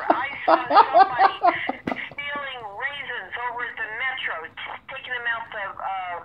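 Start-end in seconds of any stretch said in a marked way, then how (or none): 0.1-3.7 laughing. Real, not acted. Laughing while telling theft story.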